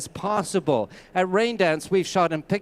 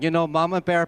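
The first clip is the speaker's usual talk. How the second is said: normal talking voice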